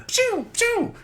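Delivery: very high squeaky pew pew like a child playing; kid blaster noises. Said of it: "they start going".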